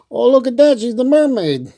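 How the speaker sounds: bright delighted gasp, pitched high; excited. Real, not acted. Reader spots a mermaid in the book, excited. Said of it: "excited reaction to picture".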